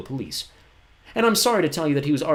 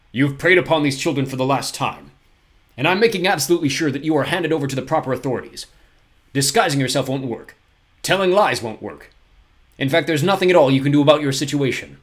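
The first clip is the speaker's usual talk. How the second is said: louder, stern lecture; 4 semitones higher, 8 dB louder than usual. A narrator's reading. Poe lectures Olaf, sternly. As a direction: lay down the law. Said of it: "spoken so sternly".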